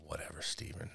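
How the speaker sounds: whispered aside. Real, not acted. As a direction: breathe it as a muttered aside. Aside after pronunciation spat.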